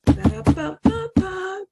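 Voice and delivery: high hummed drumroll; mock drumroll. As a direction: build suspense with a silly drumroll. Drumroll before joke punchline.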